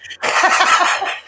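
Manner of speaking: high, wheezy cackle; re-enacted laugh. Colleague watching the presenter's mistake, high wheezy laugh. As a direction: act out helpless wheezing laughter. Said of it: "I'm smiling, I'm going".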